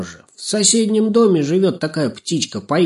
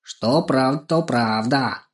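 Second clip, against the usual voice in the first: stately, a touch proud; proud dignity; 3 semitones higher, slower than usual. A narrator's reading. Crow accepting praise for her wisdom, proud and dignified. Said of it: "гордо подняв голову, с достоинством".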